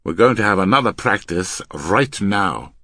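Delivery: loud, firm order; grim command. Acted. Man ordering his partner to practise, grim. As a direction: lay down the order loudly. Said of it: "the man answered grimly".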